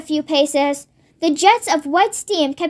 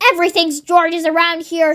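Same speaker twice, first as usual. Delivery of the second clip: ordinary delivery, nothing marked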